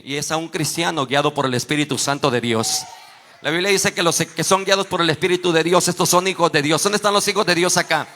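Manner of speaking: loud, driving sermon delivery; full-force preaching. Real, not acted. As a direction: proclaim it forcefully to the whole congregation.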